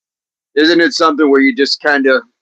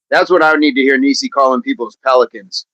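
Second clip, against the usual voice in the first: ordinary delivery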